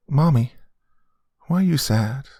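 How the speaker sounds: put-on high, questioning child voice; little girl. Daughter asking her sad mother, little-girl voice. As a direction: ask as a concerned small girl.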